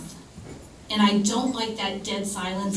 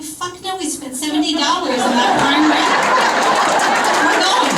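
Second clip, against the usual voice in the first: higher and louder, a defiant refusal; defiant retort; 6 semitones higher, 6 dB louder than usual. Retold or imitated. Telling her wife they're still going to the dinner party, loud and defiant. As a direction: insist loudly, nothing will stop this party. Refusing wife's offer to cancel.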